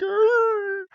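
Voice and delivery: high, strained crying creature wail; mock Pokemon crying noise; crying Lapras. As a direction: cry out like a hurt sea creature. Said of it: "She's like".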